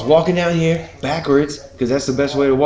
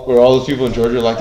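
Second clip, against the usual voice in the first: usual speaking voice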